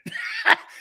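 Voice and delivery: breathless high-pitched laugh; high cackle. Real, not acted. Host cracks up at his own taunt, high cackle. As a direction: cackle at the joke.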